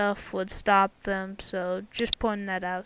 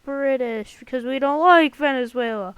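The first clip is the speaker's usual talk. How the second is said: mock cheer; 2 semitones higher, 9 dB louder than usual. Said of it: "And then they're like".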